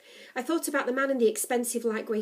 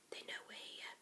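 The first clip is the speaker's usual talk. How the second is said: fearful, secretive whisper; 22 dB quieter than usual. A narrator's reading. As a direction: whisper a warning unheard by others. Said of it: "I whispered".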